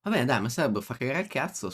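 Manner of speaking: usual speaking voice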